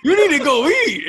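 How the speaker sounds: high, loud, laughing urgency; high, loud laughing plea; alarmed urging. Retold or imitated. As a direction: tell him off like a panicked tattoo artist. Artist urging him to eat.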